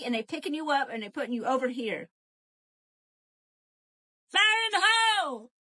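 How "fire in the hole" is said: much higher, louder shouted warning; hammed-up battle cry; mock alarm call. Playful exclamation.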